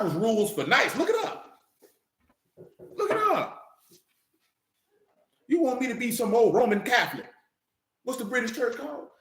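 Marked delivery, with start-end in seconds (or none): 1.0-3.6 scornful challenge. Real, not acted. Repeated scornful high challenge.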